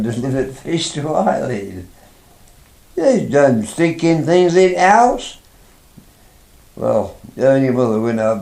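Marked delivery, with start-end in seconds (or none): 2.9-5.4 disgusted complaint. Retold or imitated. Mother complaining about smelly fish.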